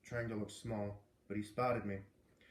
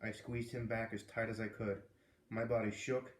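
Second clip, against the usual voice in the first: ordinary delivery, nothing marked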